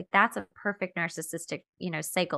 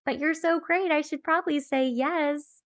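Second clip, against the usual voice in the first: higher, flustered people-pleaser; torn inner voice; 7 semitones higher than usual. Retold or imitated. Inner voice pressured into commitment, higher and flustered. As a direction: sound swept along though your body says no. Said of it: "And you're like".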